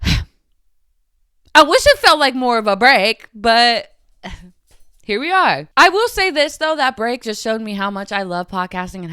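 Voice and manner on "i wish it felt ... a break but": heavy sigh. Real, not acted.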